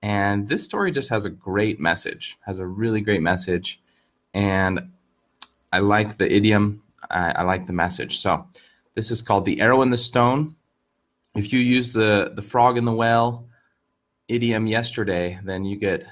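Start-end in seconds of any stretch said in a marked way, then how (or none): none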